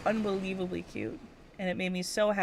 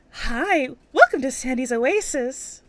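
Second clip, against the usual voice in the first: higher, louder shopkeeper greeting; voicing Sandy; 6 semitones higher, 8 dB louder, slower than usual. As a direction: greet like a bubbly shop owner. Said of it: "what's a voice for her?".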